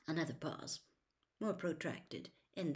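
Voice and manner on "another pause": plain reading voice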